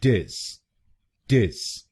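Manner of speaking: टिस spoken as a short "tis"; second syllable alone. Shown as a demonstration. Teacher isolates the stressed second syllable. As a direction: say टिस crisply on its own. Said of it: "हम बोलेंगे".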